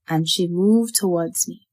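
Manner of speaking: plain reading voice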